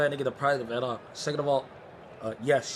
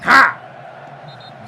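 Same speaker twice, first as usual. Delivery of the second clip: excited shout; 7 semitones higher, 12 dB louder than usual. Real, not acted. Cheering a header goal.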